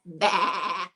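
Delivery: bleating baa; a wobbly sheep bleat. Pretend. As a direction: bleat like a lamb. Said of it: "it says".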